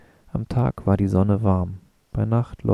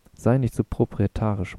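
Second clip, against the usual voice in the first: ordinary delivery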